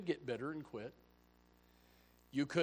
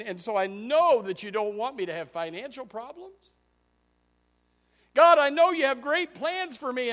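loud, higher, rising into a frantic plea; hammed-up demanding prayer building to a shout; mock pleading prayer; 9 semitones higher, 14 dB louder than usual. Mock prayer demanding God's plans.

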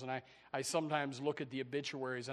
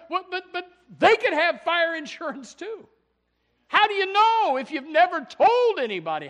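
rising from level to a loud high shout; preaching at full force; 12 semitones higher, 14 dB louder than usual. Acted. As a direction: build the questions into a fiery shout.

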